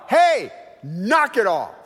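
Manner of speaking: a loud, slow shouted warning; retold yell. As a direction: call it out loud across the field. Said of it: "and I yell".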